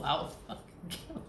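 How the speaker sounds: soft whispered half-joke threat. Real, not acted. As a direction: breathe the threat under your breath. Muttered threat about the band.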